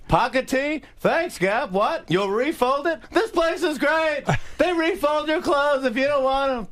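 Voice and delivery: high, loud Seinfeld impression; Seinfeld yelling. Comic and Seinfeld yelling back and forth as Seinfeld. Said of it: "we're just yelling back and forth".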